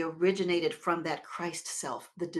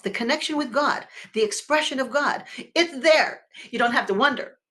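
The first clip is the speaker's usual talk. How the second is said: rising preaching fervor; 5 semitones higher, 6 dB louder than usual. Real, not acted. Preacher building to a climax.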